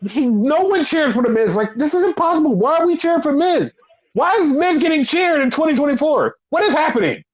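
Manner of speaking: very high, exasperated disbelief; bewildered, high-pitched protest; incredulous rant. Real, not acted. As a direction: fire off baffled questions high in your voice. Baffled rant about crowd cheering.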